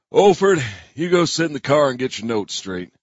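lightly voiced sheriff giving orders; a hint of a stern sheriff. A narrator's reading.

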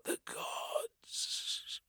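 barely voiced breath; breathy whisper. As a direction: breathe the words out, almost gone. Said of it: "he breathed".

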